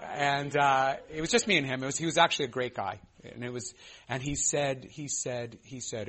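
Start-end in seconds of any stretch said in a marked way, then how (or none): none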